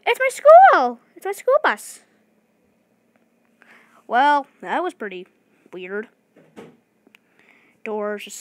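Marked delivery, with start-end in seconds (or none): 0.0-2.0 very high, slow gleeful cry; thrilled to see it; delighted squeal. Real, not acted. Kid gamer spotting his school bus, squealing with delight.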